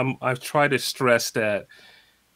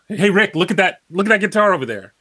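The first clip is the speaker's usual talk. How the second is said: higher, louder, urgent call to Rick; eager call; 6 semitones higher, 5 dB louder, faster than usual. Shown as a demonstration. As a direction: grab his attention, excited. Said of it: "I just said the same thing three different ways".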